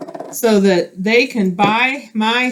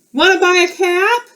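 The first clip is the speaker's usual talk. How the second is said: high, louder door-to-door sales call; peddler calling; 9 semitones higher, 4 dB louder than usual. Acted. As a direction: call out brightly to the neighbours. Peddler knocking on doors.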